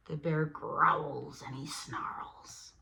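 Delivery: slow growly whisper; hushed suspense; menacing whisper. Acted. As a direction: creep it like a prowling bear. Reading bear waking.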